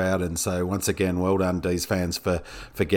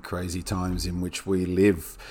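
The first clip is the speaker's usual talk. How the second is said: normal talking voice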